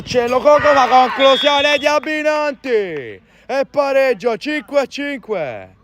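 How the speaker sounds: high, loud, drawn-out equaliser call; breathless italian goal commentary. Real, not acted. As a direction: build and stretch the goal call. Equalising goal called live. (telecronaca del pareggio, esultante)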